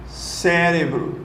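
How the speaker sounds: CÉ-re-bro, first syllable stretched and stressed; slow stressed syllables. Shown as a demonstration. Teacher to students, slowly stressing cérebro. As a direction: drag out cérebro, leaning on CÉ. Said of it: "é proparoxítona".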